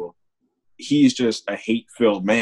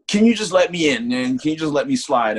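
higher, wheedling put-on voice; imitating the old man pleading; 5 semitones higher than usual. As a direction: beg to be let in as the old man, voice raised. Old man begs to get in.

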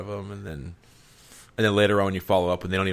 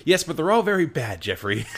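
mom voice; 8 semitones higher than usual. Retold or imitated. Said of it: "interrupted and saying".

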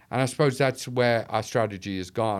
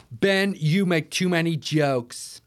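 slow, higher-pitched mock American accent; fake American accent; 6 semitones higher, 4 dB louder, slower than usual. Event-goers chiding the speaker, fake American accent. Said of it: "I'm doing a fake American accent".

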